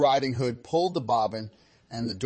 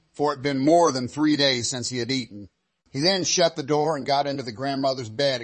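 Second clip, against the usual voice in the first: plain reading voice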